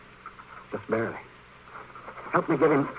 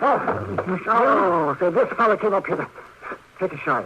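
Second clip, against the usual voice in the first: higher, louder, shaken babble; flustered rush; 7 semitones higher, 7 dB louder than usual. Acted. Doctor telling the marshal about the shootout, flustered and shaken. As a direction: spill it out all rattled.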